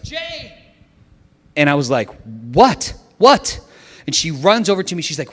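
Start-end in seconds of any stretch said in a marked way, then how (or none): 0.0-0.5 high, held-back mock scream; imitated terrified scream. Wife calling her husband over a missing child, imitated scream. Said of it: "suddenly hear this terrifying scream". 2.5-3.6 loud, high, sudden "what?"; startled reply. Retold or imitated. Father answering his wife's scream, re-enacted startled shout.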